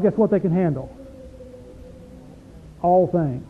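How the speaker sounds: normal talking voice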